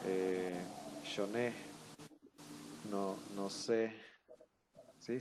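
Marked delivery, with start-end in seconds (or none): 1.0-1.5 SHONÉ stressed on the final syllable; acento agudo. Shown as a demonstration. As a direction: land on the NÉ of shoné. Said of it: "con el acento en la última sílaba". (shoné con el acento en la última sílaba)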